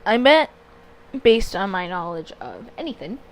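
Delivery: normal talking voice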